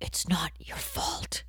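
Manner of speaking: slow hushed whisper; whispered movie line. Retold or imitated. Quoting the Good Will Hunting scene in a whisper. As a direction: breathe it tenderly. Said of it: "Every time he's like".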